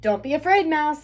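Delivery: ordinary delivery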